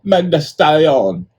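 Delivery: loud, high, drawn-out mock Jamaican accent; bad Jamaican accent. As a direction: call it out in a hammed-up Jamaican accent. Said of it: "my terrible Jamaican accent".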